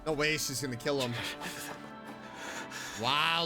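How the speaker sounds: ordinary talk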